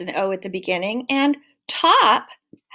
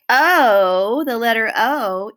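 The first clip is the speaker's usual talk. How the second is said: louder, a bold reveal of the letter; announcing the answer; 5 dB louder, much slower than usual. Shown as a demonstration. Teacher to children revealing the letter, loud and proud. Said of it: "you are absolutely right".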